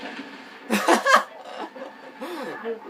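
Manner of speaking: big burst of loud, high laughter. Real, not acted. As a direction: laugh hard, helplessly. Risada após a granada. (gargalhada alta)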